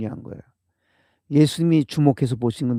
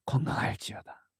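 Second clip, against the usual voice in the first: whispered blessing; 3 semitones lower, 8 dB quieter than usual. Acted. Quoting Jesus's blessing.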